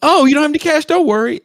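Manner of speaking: loud, very high mock-helpful cop; eager cop. Cop reassuring a man without cash, loudly eager. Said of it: "and the cop goes".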